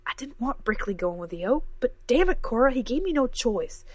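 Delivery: damn it, you gave me no choice; upset defense. A narrator's reading. Professor defends herself to Cora, loud and heated.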